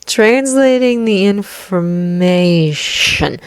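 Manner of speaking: very slow, thoughtful repetition; slow pensive musing. Acted. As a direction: let the idea dawn slowly. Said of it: "Izuku hums, pensive".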